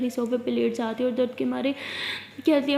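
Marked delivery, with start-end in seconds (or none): none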